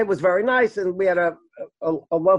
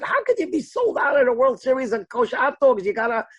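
higher-pitched indignant disbelief; mock outrage, pitched up; incredulous protest; 5 semitones higher than usual. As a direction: retell your baffled complaint to the vendor. Teller acts his protest at vendor.

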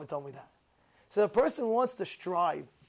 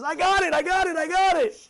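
excited eureka; 7 semitones higher, 5 dB louder than usual. Retold or imitated. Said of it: "He says".

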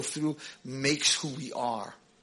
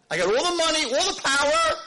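much higher and louder, showing off wealth; boastful prince; 7 dB louder than usual. Retold or imitated. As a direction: brag like a spoiled heir.

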